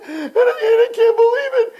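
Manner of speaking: high-pitched hammed-up blubbering; mock sobbing. Girl walks out of the movie, mock sobbing. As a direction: imitate a girl bawling over a film. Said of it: "their eyes are all swollen".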